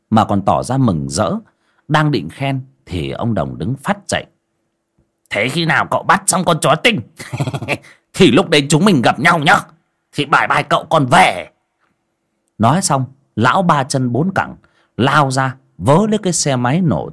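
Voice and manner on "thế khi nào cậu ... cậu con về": sneaky cheerful exit. A narrator's reading. Đồng cheerfully dodging the hunt, higher and bright. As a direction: slip away with a grin.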